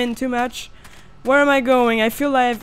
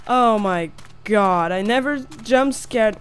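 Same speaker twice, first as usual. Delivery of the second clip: ordinary delivery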